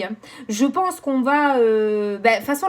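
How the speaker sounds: ordinary talk, nothing marked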